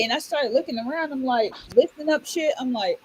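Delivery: usual speaking voice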